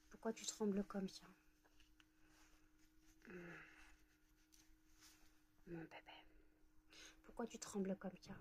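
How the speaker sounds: soft, soothing pet talk fading to a murmur; tender concern. Real, not acted. Asking the trembling pet why, soft and soothing. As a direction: comfort a trembling pet very softly.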